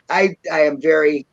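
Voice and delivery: normal talking voice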